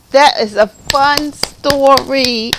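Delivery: high, loud, slow and emphatic; enthusiastic. Real, not acted. Reader praising the story to children, emphatic delight. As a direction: savour it with big enthusiasm.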